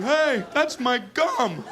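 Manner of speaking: high, slow, drawn-out complaint; whiny kid protest. Acted. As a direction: protest helplessly as a picked-on child. Child protesting to pickpocket infielders.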